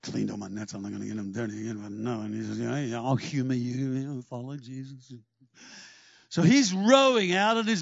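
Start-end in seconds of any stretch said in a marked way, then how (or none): 0.0-5.1 low, soft grumble, the first lines rushed; grudging Peter. Acted. Peter grumbles to himself about dirtying his clean nets. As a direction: grumble like a tired fisherman humouring someone.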